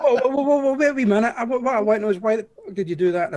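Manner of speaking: high, loud interrupting objection; mock protest. Real, not acted. Listener stopping the joke-teller, mock indignant protest. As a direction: cut in loudly to halt everything.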